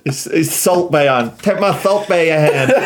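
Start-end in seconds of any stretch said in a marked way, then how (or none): 1.4-2.9 laughing. Retold or imitated. Laughing while quoting the bit.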